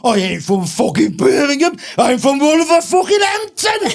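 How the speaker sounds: slow, loud, high mock Wolverhampton shout; hammed-up Wolverhampton man taking the hump; indignant Wolverhampton accent. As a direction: bellow offended in a broad Wolverhampton accent. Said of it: "He got the right fucking hump".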